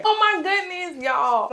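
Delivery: overwhelmed with delight; excited scream. Real, not acted. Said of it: "I'm sorry for all the screaming".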